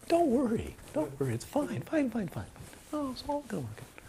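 high, soft, soothing patter; retold reassurance. Retells reassuring crying student.

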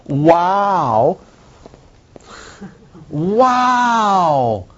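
rising, high-pitched gasp of wonder; awed wow. Retold or imitated. Teacher recalls blowing bubbles, awed wow. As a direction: marvel at a soap bubble like a child.

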